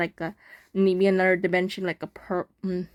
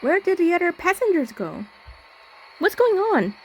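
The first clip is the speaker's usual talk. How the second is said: high, rising frightened questions; panicked; 10 semitones higher than usual. Acted. Player voicing the lone passenger in panic, much higher. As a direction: voice climbing in alarm. Narrator panics on empty train.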